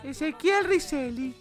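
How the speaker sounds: ordinary talk, nothing marked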